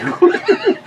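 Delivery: high-pitched giggle; high laugh. Real, not acted. Storyteller laughing after the grandpa punchline, high giggle.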